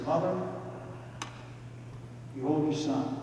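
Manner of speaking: dying whisper. Acted. Dying Jesus speaks to mother.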